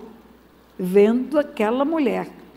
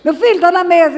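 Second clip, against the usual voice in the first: loud, higher call to the table; calling a child; 5 semitones higher, 7 dB louder than usual. Retold or imitated. Parent calling the son to lunch, loud and higher. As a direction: call the kid from the kitchen.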